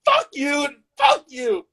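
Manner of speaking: high, slow drawn-out curse; furious screech. Acted. Shot man cursing the young couple, furious high screech. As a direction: screech each curse out long.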